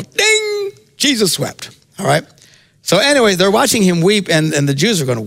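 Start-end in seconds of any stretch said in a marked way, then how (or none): 0.0-1.7 high bell-like Ding, then the answer; quiz show answer. Pretend. Jeopardy contestant buzzing in with a high Ding. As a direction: buzz in like a game show contestant. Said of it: "you can go".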